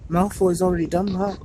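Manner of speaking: choked up. A narrator's reading. Neville to his friends, mildly choked up. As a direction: fight back tears on the words. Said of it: "Neville choked".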